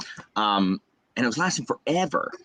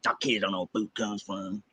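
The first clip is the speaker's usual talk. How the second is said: low-key, softer Southern dad; dad's Southern accent; 4 semitones lower, 8 dB quieter than usual. Retold or imitated. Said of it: "they have strong Southern accents".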